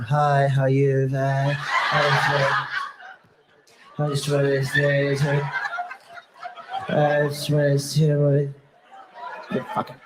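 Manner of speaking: slow, halting monotone chat-up; mock timid suitor. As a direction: stumble through a feeble approach, dragging words. Imitating young men approaching women.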